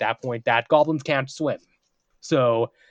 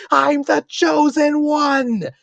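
high, loud, drawn-out triumphant yell; 7 dB louder, much slower than usual. Retold or imitated. Fleeing goblin proclaims himself to the forest, yelling. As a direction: shout it slowly like a self-crowned hero.

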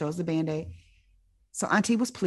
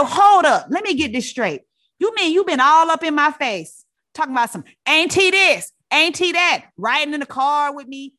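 louder and higher, a long offended rant; auntie ranting; 7 semitones higher, 6 dB louder than usual. Retold or imitated. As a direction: hold up, you been all up in my face. Auntie rants in lobby.

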